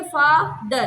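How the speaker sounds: "Father" broken into फा, then दर; split into syllables. Shown as a demonstration. Teacher showing a two-syllable word, "father" split in two. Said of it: "फा एक syllable".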